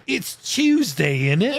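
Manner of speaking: slow, drawn-out mock British; British accent. Shown as a demonstration. American doing British slang for a friend, mock British accent. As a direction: stretch out a cheeky British catchphrase. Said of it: "you can become British".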